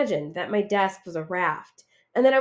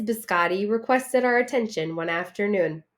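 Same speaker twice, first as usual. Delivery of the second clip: ordinary delivery, nothing marked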